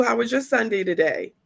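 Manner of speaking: ordinary talk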